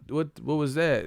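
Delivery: ordinary talk, nothing marked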